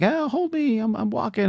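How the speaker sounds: high, sing-song pretend balancing; squeaky playful "hold me, I'm walking"; playful mom voice. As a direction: mom playing along with her kid. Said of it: "playing with me".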